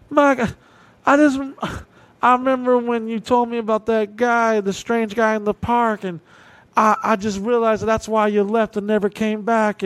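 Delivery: high, emotional mother's voice; lightly voiced remorseful mom; drunk mom. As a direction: voice her tipsy and sorry.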